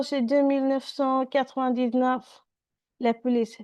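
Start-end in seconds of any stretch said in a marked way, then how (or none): 0.0-2.4 rattled off. Acted. Donald repeats number quickly.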